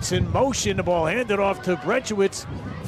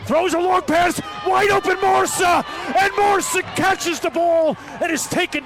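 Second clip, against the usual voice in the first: excited shout; 6 semitones higher than usual. Real, not acted. Play-by-play of a long pass.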